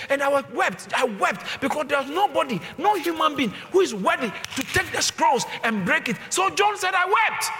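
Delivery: high, loud shouted sermon climax; preaching at full force. Acted. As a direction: cry it out to the congregation, rising to a roar. Preaching retelling John's vision.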